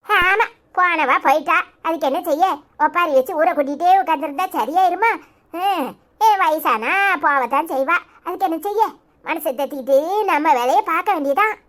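granny shrugging it off; resigned grandmother. Acted.

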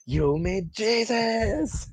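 mock yell. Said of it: "she just yells".

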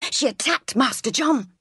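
lightly voiced shocked maid; scandalised servant. Servants pulling the children apart, mildly scandalised.

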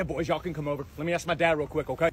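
usual speaking voice

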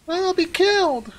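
put-on squeaky high toddler cry; mocking toddler voice. Echoing the panicky soldier as a whiny toddler. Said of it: "Oh, you sound like a toddler".

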